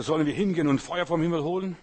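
a loud, heated quoted demand; indignant disciples' cry. Retold or imitated. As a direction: belt it out like zealous disciples. Disciples ask to call down fire.

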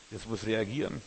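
ordinary delivery

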